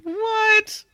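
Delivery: disbelieving yelp; surprised. Real, not acted. Disbelief at survey.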